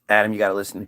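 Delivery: now she's smart and grave; low grave new voice; Eve's new voice. Retold or imitated. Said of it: "Eve goes".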